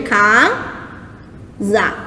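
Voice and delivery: "casa" as two separate beats, "ca... za"; long pause between "ca" and "za" in "casa"; split into syllables. Shown as a demonstration. Teacher shows students a line break, "casa" split by syllable. Demonstrating syllable division on board.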